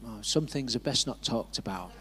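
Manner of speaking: low, soft and secretive; mock-mysterious understatement; mysterious hush. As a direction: keep them guessing with a hushed tease. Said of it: "And I just went".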